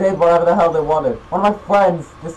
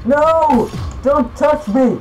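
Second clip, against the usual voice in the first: high-pitched frantic yell; real gamer panic; panicked protest; 8 semitones higher, much slower than usual. Real, not acted. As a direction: cry out to fend off an attacker. Fending off attacker.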